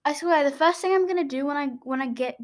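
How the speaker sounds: ordinary talk, nothing marked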